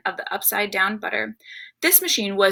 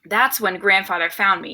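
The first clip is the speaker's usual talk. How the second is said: usual speaking voice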